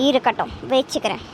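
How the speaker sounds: ordinary talk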